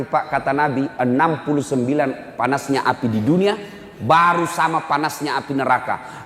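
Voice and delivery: ordinary talk, nothing marked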